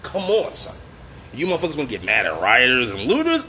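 ordinary talk, nothing marked

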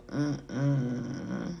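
low, soft drawn-out "mm-mm"; retold disapproval. Woman recalling her reaction to his changed tone, soft disapproving hum. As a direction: hum a quiet refusal. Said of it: "I just be like".

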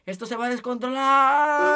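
mock meme voice; quoting the video. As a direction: ham up the viral line.

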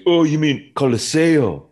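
high, slow mock Italian accent, Coliseo drawn out; smug local in a hammed-up Italian accent. Roman local correcting the tourist in mock Italian accent. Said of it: "He was like".

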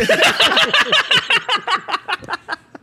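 loud high cackle; big laugh. Real, not acted. Comedian cracking up at his own Xbox joke, loud high laugh.

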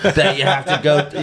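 very high voice building into laughter; laughing through the bit. Retold or imitated. Retelling the skit, very high, laughing. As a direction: squeal the rules, cracking up. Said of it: "and he's like".

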